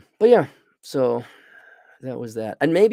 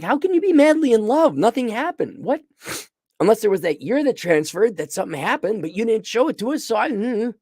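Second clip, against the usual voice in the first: incredulous complaint; 10 semitones higher than usual. Retold or imitated. Reviewer questioning the sudden love: high incredulous. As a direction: protest the instant love story.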